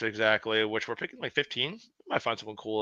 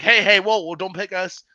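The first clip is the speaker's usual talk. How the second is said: higher, loud burst at first; mock urgent warning; 6 semitones higher, 7 dB louder than usual. Teams warning us before we made our picks, mock urgent. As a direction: imitate someone hurriedly waving people off.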